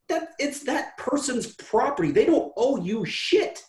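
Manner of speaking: high, half-laughing retold tirade; heated rant. Host retelling his rant at the entitled student, heated and laughing. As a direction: rant at her, amused at your own heat.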